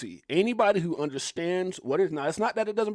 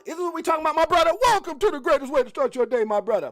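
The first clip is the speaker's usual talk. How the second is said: loud, high hollered welcome; hearty shout-out; 9 semitones higher, 6 dB louder than usual. Real, not acted. Host greeting a viewer on the morning show, hollered. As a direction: call out big to a brother.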